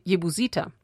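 usual speaking voice

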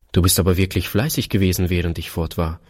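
ordinary delivery, nothing marked

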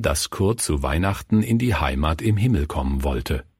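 ordinary delivery

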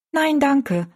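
put-on high girl's voice; politely declining girl.

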